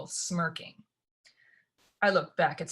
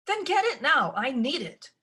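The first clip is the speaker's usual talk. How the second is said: bossy Lucy; 8 semitones higher, 4 dB louder than usual. A narrator's reading. Lucy demands bag.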